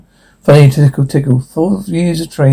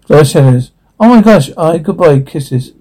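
ordinary talk, nothing marked

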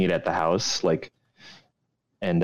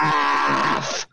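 shouted; 5 semitones higher than usual. Real, not acted. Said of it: "calls out pass".

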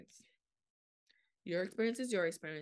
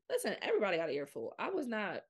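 loud and fast declaration; fired up, no holding back; emphatic; 4 semitones higher, 6 dB louder, faster than usual. Real, not acted. Emphatic, louder and faster.

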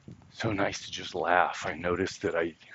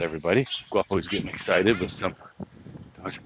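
usual speaking voice

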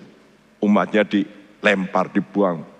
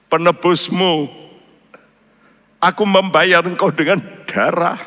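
high, emphatic, insistent refusal; Yesus; 7 semitones higher than usual. Acted. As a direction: speak as the redeemer who will not give up, pitch raised, bursts of force. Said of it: "saat itulah Tuhan berkata dalam hati saya". (suara Yesus yang tegas, bernada tinggi)

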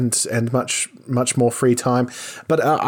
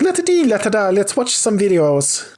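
high, lilting mock Irish; Irish accent; 8 semitones higher than usual. Podcaster showing how he'd entertain listeners, mock Irish accent. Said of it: "like, an Irish accent the whole time".

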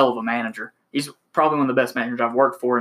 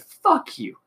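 much higher, spat-out angry curse; 14 semitones higher than usual. Retold or imitated. Salesman cursing out the manager, angry shout. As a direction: fling it right at him.

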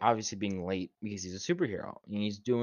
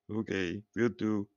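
lightly done robot voice; flat mock robot reply. Shown as a demonstration. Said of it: "I'm gonna do my robot voice".

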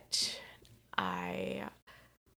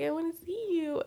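high pleading voice; 10 semitones higher than usual. Retold or imitated. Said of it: "in my head".

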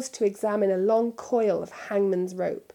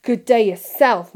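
louder, resentful retort; bitter sneer; 3 semitones higher, 10 dB louder than usual. A narrator's reading. Borgin alone sneering at Malfoy. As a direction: spit it after him. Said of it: "dropped his oily manner".

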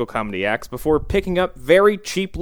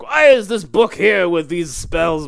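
slow, raised, put-on complaining voice; fussy old mage; 4 semitones higher, 5 dB louder than usual. Old mage to a bad librarian, mock-indignant complaint. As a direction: play a bumbling elder querying the shelving, louder.

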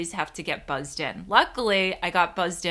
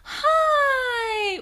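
much higher, a voice up an octave; high baby-talk hi; 3 dB louder than usual. Shown as a demonstration. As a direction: squeak it like to a puppy. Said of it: "if I go up an octave".

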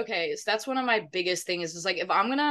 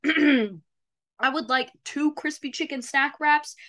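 slow, higher, deliberate mock order; ceremonious ordering; 6 semitones higher than usual. Customer at the drive-thru speaker, slow ceremonious mock order. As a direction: order grandly, word by word.

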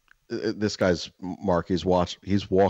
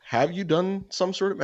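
higher, curious questioning voice; a light higher neighbor voice; 12 semitones higher than usual. Retold or imitated. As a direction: play the neighbor probing, pitched up. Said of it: "And he's just like".

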